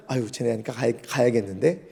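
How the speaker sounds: low, soft musing; muttering to oneself. Retold or imitated. Jesus seeing the struggling disciples, muttering to himself. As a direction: think aloud under your breath.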